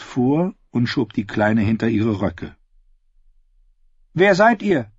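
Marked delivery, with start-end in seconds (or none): 4.1-4.9 put-on wary girl demanding.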